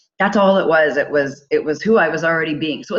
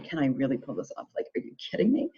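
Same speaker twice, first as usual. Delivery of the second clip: hushed, nervous self-doubt; breathy worried half-whisper to herself; 4 semitones lower, 9 dB quieter, faster than usual. Retold or imitated. Doubting she can pull off the panel talk, hushed and nervous. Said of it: "shaking in my boots going".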